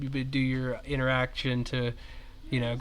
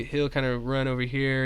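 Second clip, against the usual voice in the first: ordinary talk, nothing marked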